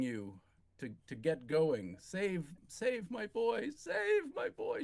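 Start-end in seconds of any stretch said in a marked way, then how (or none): 2.0-4.8 rising, much higher slow plea; a foreign mother, nearly hysterical, pleading; almost hysterical. Acted. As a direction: beg with rising panic. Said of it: "She's almost hysterical".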